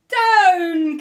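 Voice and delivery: high, very loud call; cried out. Acted. Witch ordering the broom down, loud cry. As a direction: shout it down urgently. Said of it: "cried the witch".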